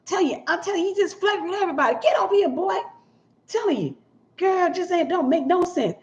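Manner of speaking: high, quick gossipy voice; gossiping church lady. Retold or imitated. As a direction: cluck about it like a church gossip.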